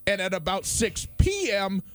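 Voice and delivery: high, slow wistful yearning; longing daydream. Real, not acted. Host dreaming aloud to his co-host about floating. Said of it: "But I hate it".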